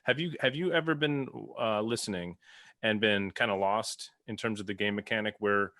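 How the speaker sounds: ordinary talk, nothing marked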